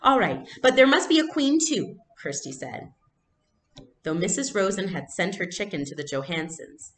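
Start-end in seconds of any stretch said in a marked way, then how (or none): 0.0-2.0 put-on much higher, louder child's demand; little sister haggling with her mother; bargaining little girl. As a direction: insist like a small child.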